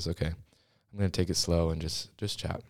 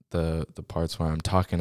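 ordinary talk, nothing marked

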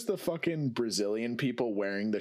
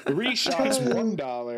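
carnival barker; 9 semitones higher, 4 dB louder, slower than usual. Retold or imitated.

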